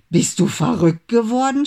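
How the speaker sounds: indignant scolding shout. A narrator's reading. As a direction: tell him off as a furious big brother. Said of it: "schimpfte Max".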